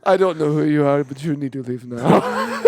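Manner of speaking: low, fast, fading threat; a lightly voiced guard telling him to leave; mock elf guard threat. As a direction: warn him off like a tough guard.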